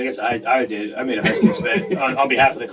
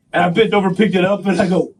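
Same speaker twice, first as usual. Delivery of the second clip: ordinary talk, nothing marked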